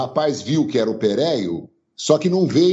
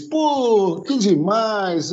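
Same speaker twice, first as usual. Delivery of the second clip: gushing fan; 8 semitones higher, much slower than usual. Retold or imitated.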